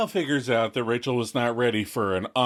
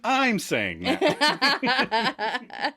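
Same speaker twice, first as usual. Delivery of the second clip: high, slow laughing aside; giggling through the words; 11 semitones higher, much slower than usual. Real, not acted. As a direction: laugh it out as you say it. Laughing at own joke.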